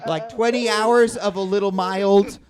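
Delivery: ordinary talk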